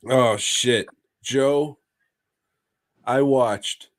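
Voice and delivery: ordinary delivery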